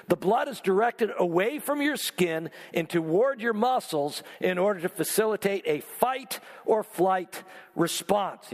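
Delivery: normal talking voice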